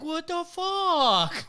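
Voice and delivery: very high, slow, squeaky outrage; offended cat voice. Retold or imitated. Friend voicing his cat woken up, high slow squeaky outrage. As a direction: play the rudely woken cat.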